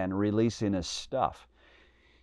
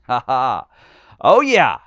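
mocking; 8 dB louder than usual. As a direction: jeer at them with relish. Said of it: "Elijah began mocking them".

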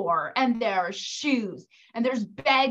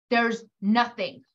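ordinary delivery

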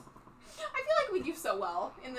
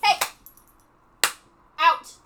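shooing; 5 semitones higher, 11 dB louder than usual. Retold or imitated. King dismisses the visitors.

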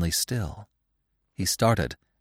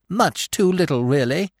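eager agreeing squirrel; 8 semitones higher, 4 dB louder than usual. A narrator's reading. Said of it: "the squirrel agreed".

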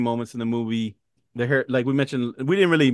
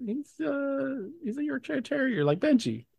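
high put-on voice; 14 semitones higher, 5 dB quieter than usual. Acting Healy's reply.

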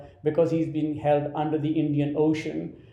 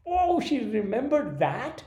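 astonished; 8 semitones higher, slower than usual. Retold or imitated. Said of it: "And I was like".

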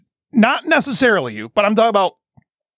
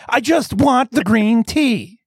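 slow, higher, acted pleading; drawn-out mock desperation; exasperated plea; 4 semitones higher, much slower than usual. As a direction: spell it out wearily, word by word. Retelling his exasperated plea.

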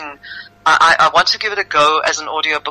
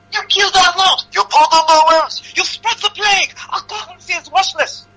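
high-pitched shouted accusations; angry mob. Acted. Crowd turns on the outsider, angry shouting. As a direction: hurl each charge in a high yell. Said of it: "Their anger bubbled up".

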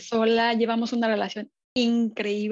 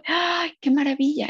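higher, louder, slowed sigh of wonder; delighted exclamation; 6 semitones higher, 5 dB louder than usual. Retold or imitated. Arriving home in Mexico, a louder delighted cry. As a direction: savor each word in delight. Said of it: "es así como".

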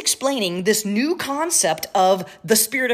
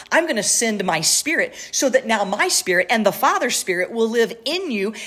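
ordinary delivery

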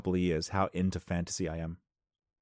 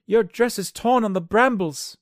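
high, loud, slow squeaky tailor; a shrill little tailor, slow; 10 dB louder, slower than usual. Acted. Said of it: "said the tailor".

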